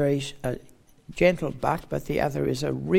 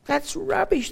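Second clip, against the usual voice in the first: much higher, scoffing voice; high, scornful dismissal; mocking pagan; 12 semitones higher than usual. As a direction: scoff at Paul as a Corinthian would. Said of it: "they'd say".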